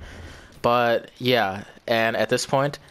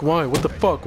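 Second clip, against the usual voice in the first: higher, irritated just-woken brother; annoyed brother; 4 semitones higher than usual. Retold or imitated. Brother, woken at night, annoyed and higher. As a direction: grumble it like a rudely woken sibling.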